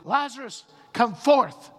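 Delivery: high, loud command across a distance; commanding shout. Acted. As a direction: shout it out as a forceful summons. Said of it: "and he shouted".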